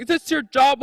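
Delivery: high, loud shrieking question; panicked mock shout. Lady shrieking as the wave knocks her down, panicked mock shout. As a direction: yell it as the wave hits. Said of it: "she's like".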